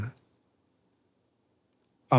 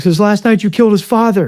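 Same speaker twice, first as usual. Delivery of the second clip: high, loud accusing cry; distraught outburst, pitched way up; retold distraught accusation; 10 semitones higher, 9 dB louder than usual. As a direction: blurt it out as the frightened kid. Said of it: "getting nervous, like I'm about to cry".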